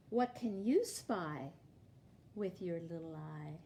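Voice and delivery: sing-song picture-book read-aloud, each clue lifted; reading to children. Acted. Teacher reading a picture book to her class, storytime sing-song. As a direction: read it like storytime for little kids.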